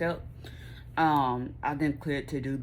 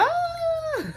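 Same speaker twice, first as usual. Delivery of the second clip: high happy scream; a sudden shriek of pure joy; joyful shriek; 4 dB louder than usual. Real, not acted. Joyful outburst.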